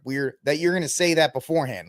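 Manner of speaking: ordinary talk, nothing marked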